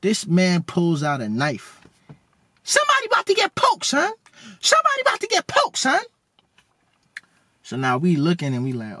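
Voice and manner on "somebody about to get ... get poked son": very high, squeaky voice out of a big man; Theo's squeaky voice. Retold or imitated. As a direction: squeak every nah up high like Theo. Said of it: "his voice is squeaky as hell".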